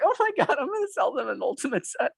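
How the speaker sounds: high-pitched, laughing glee; giddy excitement. Retold or imitated. Salesman in an appointment thinking, giddy with excitement. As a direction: squeal with delight at the coming sale. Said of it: "I get a little bit emotional".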